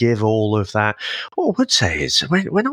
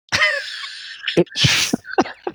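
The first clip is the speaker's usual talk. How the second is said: high, wheezy laugh; burst of laughter. Real, not acted. Co-host amused by the endorphin plot, bursting out laughing. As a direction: crack up with a high airy laugh. Listener cracks up at the explanation.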